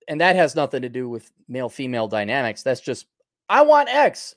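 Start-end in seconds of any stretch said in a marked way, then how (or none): 3.5-4.3 high, loud mock demand. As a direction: imitate someone demanding their way loudly. Acting out someone demanding X.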